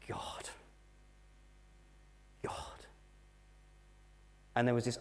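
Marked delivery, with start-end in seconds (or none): 0.1-2.9 a soft, hushed call to God; near-whispered retold prayer; hushed plea. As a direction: breathe the name out softly, spent. Lying injured in hospital, crying out.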